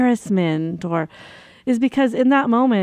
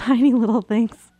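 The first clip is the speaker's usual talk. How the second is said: ordinary delivery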